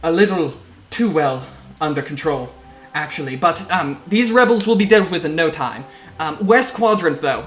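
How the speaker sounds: lightly voiced officer covering up trouble; halting reassurance from a harried captain, a touch forceful; Captain Lawrence, rattled. Game master plays rattled Captain.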